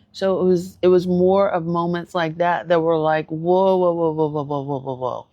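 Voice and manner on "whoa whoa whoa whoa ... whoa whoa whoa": fading whoa. Retold or imitated. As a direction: start stunned and let the whoas fade away. Stunned repeated whoa.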